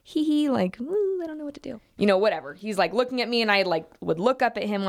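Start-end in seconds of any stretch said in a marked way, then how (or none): 0.0-0.5 high, awkward fake titter; mock uneasy giggle, pitched up; uncomfortable giggle. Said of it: "just, like, this uncomfortable". 0.8-1.8 high, soft helpless voice; small, pitched-up nervous mock voice; uncomfortable. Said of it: "just, like, this uncomfortable".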